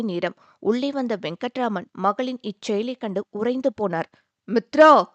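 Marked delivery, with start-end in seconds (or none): 4.5-5.0 loud, sharp call of her name; father calls out to his daughter, stunned; raised voice. A narrator's reading. Father catches daughter in the act, calling out loudly. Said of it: "என குரலை உயர்த்த".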